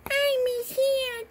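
high mock-sad little baby; crying baby voice. Little baby missing Hannah, high pretend crying voice. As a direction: whine it like a tiny crying baby. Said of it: "baby go".

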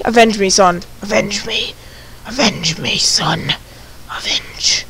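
dying words fading to a whisper; overacted death scene. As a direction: fade out like a dying hero.

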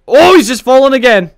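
high, loud burst of amusement; excited exclaim. Real, not acted. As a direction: blurt it out in disbelief. Suspect falls off roof.

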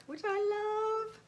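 high, drawn-out singsong; singsong gushing. Real, not acted. Vlogger delighted by a free sticker, high singsong gushing. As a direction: stretch it out high on a held last word.

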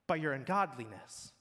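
normal talking voice

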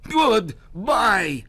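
high, loud angry farewell; loud goodbye. Acted. Goblin shouting goodbye to Herschel, loud and high. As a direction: bellow it on the way out.

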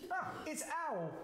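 high little mouse voice; chirpy mouse. Acted. Mouse names the visitor.

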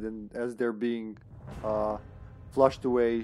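ordinary talk

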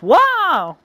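high, loud drawn-out wow; big whooping exclamation of surprise; surprised. A narrator's reading. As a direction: cry out in amazement. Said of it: "Walter is surprised".

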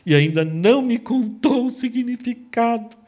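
high, loud aggrieved complaint; wife reproaching Samson, high loud whine; wife's complaint. Acted. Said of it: "E ela fala".

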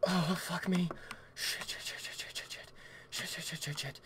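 rapid, hushed string of curses; panicked muttering. Real, not acted. Player frantically hunting for a toy, hushed panicked muttering. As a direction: mutter it over and over under the breath.

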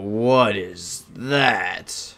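very slow, drawn-out disbelief; stunned amazement. Real, not acted. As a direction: stretch every word in astonishment. Stunned by sight in game.

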